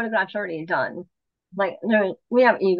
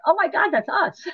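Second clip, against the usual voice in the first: thrilled; 10 semitones higher than usual. Retold or imitated. Realizing they won.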